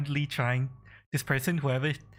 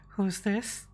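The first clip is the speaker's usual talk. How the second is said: character voice; 7 semitones higher than usual. Acted. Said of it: "System says".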